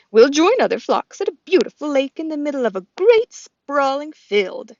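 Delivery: a friendly goose telling of the journey; lightly voiced goose. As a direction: another goose explaining the flight to Bright Bill, lightly voiced. Goose describes migration lake.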